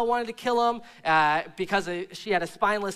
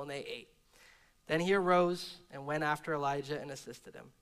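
measured, lower Bible reading voice; scripture reading; 3 semitones lower than usual. Acted. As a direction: read the passage steadily to the congregation. Reads scripture passage aloud.

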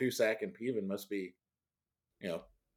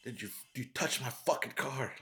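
lightly voiced rich kid threatening; menacing quote; 3 semitones higher, 5 dB quieter than usual. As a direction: lean in and menace. Quoting the rich kid's threat.

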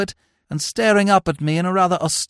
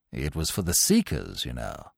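low, mild upper-class chap; apologetic young chappie, sheepish; 9 dB quieter than usual. A narrator's reading. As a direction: explain sheepishly in a lowered pitch.

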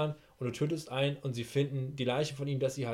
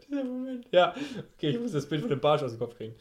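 stifled giggles; 5 semitones higher, 4 dB louder than usual. Real, not acted. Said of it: "Alter, hör auf zu lachen".

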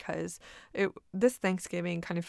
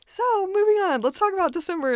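much higher and louder, forced brightness; really high-pitched voice; 13 semitones higher, 9 dB louder, faster than usual. Real, not acted. Vlogger holding back tears about a sick aunt, squeaky high voice. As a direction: push the voice way up to keep from crying. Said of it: "I'm gonna do this in a really high-pitched voice so I don't cry".

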